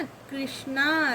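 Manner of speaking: कृष्णा slowly, with the kr brought out; r sounded. Shown as a demonstration. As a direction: stretch कृष्णा so the r is heard. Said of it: "देखिए रा लग रहा है ना".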